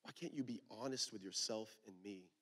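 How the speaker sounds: soft, low, tender questions; gentle questioning. Acted. God asking the angry listener, soft and tender questions. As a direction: ask softly, like a caring father. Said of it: "he says".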